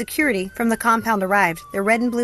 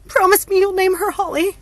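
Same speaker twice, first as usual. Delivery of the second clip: high, loud, pained insistence; through gritted teeth; 12 semitones higher, 6 dB louder than usual. A narrator's reading. Hope in labour makes her sister promise, through gritted teeth. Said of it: "said through gritted teeth".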